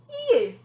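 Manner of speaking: pause, then hit "you." high and loud; dramatic pause before you. A narrator's reading. Nanny revealing her target after a dramatic pause. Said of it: "very dramatic pause".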